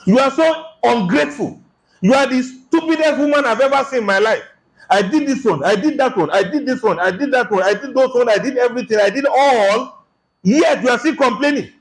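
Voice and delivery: high, indignant rant at the wife; husband berating his wife, high and indignant; angry husband rant. Retold or imitated. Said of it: "But for him to say".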